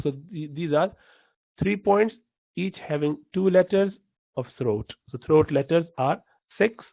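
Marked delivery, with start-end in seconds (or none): none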